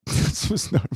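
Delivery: high, words breaking up into laughter; laughing. Real, not acted. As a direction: try to say it through a laugh. Breaks up laughing while insisting.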